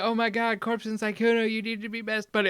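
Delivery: high-pitched mock excitement; squealed-up retold excitement; gushing fan reaction. Imitating excited onlookers' reaction.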